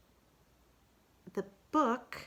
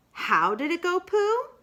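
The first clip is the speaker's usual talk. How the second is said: much higher, louder question; Rabbit prompting; 12 semitones higher, 8 dB louder than usual. Acted. Rabbit urges Pooh to recite, higher.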